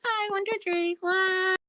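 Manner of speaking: very high little chimp puppet voice; squeaky-high puppet count-in; Johnny. Acted. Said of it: "Johnny, are you gonna be good?".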